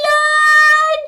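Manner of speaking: ordinary delivery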